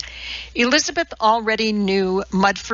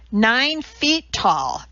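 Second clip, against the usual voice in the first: slow, every word weighted, higher; Nine feet tall spelled out slowly, each word stressed; emphasized; 5 semitones higher, much slower than usual. A narrator's reading. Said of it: "Madeline emphasized".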